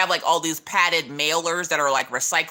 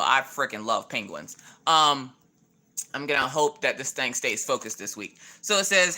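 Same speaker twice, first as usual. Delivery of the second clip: normal talking voice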